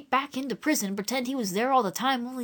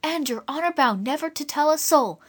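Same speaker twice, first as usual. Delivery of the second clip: persuading; 6 semitones higher than usual. Acted. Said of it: "in persuading tones".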